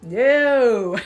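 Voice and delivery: firm refusal. Retold or imitated. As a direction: lay down the law to a ghost. Refusing the spirit moving her bed.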